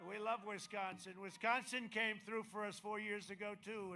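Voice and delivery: rousing campaign rally cadence; rally speech. Acted.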